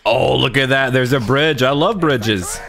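normal talking voice

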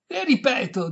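high, loud, exasperated; forceful preface to a repeat; emphatic insistence. A narrator's reading. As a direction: raise the voice to insist. Exasperated repetition to deaf lady.

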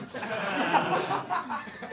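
real laugh; laughing at the joke. Real, not acted. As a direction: crack up. Laughing at own gag.

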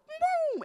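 high, loud sound-effect boom; mock divine boom. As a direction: whoop it like a highlighter swoosh from heaven. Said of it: "God will go".

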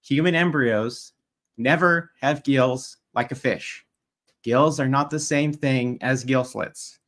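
slow, deliberate, word by word; measured and emphatic, condescendingly simple; slow, pointed. Real, not acted. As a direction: spell it out slowly with pauses, as if to a slow learner. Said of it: "say this slowly and use small words".

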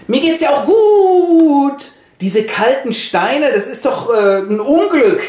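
a man losing his mind over cold stones; manic Chris. Acted. Chris insists to his wife he is fine, high and manic. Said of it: "der Chris wird immer manischer".

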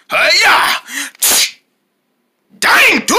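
high, loud outburst; sudden karate-style cry, then exasperated yell. Acted. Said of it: "I didn't mean to yell".

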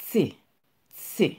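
"T" spoken as the German name of the letter C, a crisp tse. Shown as a demonstration. Teacher showing students the German C, clipped tse. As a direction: say "T" sharp, like tse. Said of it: "ou pa di C ankò, ou di".

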